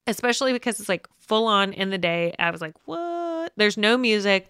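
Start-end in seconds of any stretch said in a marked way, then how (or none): 2.9-3.5 very high, soft incredulous squeak; mock disbelief. Retelling own shocked reaction to scene.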